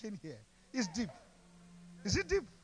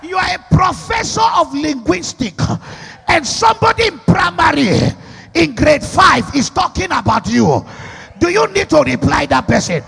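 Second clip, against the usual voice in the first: loud declamatory pulpit delivery; full-force preaching; 5 semitones higher, 20 dB louder than usual. Real, not acted. Preacher challenging whoever speaks against you, full-force preaching. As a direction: punch out each phrase to the crowd.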